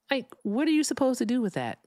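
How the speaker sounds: normal talking voice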